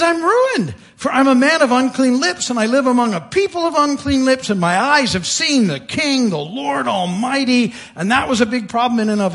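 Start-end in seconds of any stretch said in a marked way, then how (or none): 0.0-7.7 mildly acted dismay, pitched high; raised, urgent lament; overwhelmed. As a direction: cry out a confession in awe. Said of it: "Isaiah is overwhelmed".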